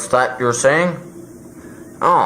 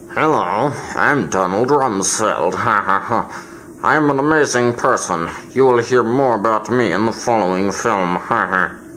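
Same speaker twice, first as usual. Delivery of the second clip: Donald Rumsfeld voice; 2 semitones lower than usual. Pretend. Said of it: "say hi to all the people, Don".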